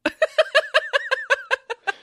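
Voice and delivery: loud high burst of laughter. Real, not acted. Laughing at banter.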